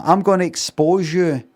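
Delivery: mock accusing outburst; retold threat. Acting out the witch's threat.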